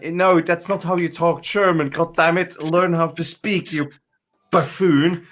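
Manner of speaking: high, indignant mock rant; exasperated scolding. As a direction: scold a bad speaker of German. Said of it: "it just makes my, ugh, my insides go".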